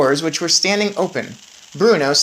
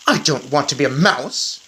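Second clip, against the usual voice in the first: plain reading voice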